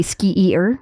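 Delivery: "skier" said as two parts, ski-eer; spelling pronunciation. Shown as a demonstration. Host showing how the word looks, "skier" as ski-eer. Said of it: "It doesn't look like it should sound like that".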